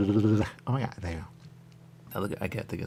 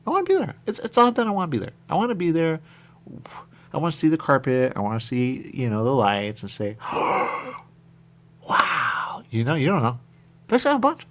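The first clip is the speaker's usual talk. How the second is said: eager loud excitement; 7 semitones higher, 9 dB louder than usual. Real, not acted. High loud eager run.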